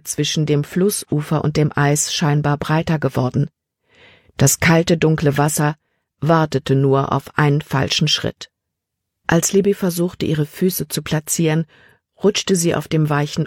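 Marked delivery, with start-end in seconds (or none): none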